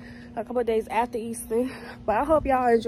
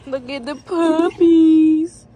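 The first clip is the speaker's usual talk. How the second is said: excited; 2 semitones higher, 7 dB louder, much slower than usual. Real, not acted. Cooing over puppies.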